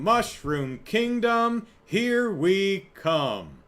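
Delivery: high, loud, drawn-out mock proclamation; Mario. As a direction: stretch each word, imitating an odd heroic delivery. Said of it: "that's Mario saying".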